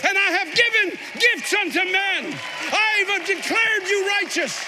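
high, ringing pulpit shout; full-force preaching. Acted. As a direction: preach it at the top of your voice.

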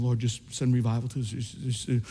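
praying softly under his breath; quiet muttered prayer. Retold or imitated. Preacher retelling his prayer while walking to church, softly murmured. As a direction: murmur the prayer to yourself, lips barely moving. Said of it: "My, my mouth was moving as I was walking".

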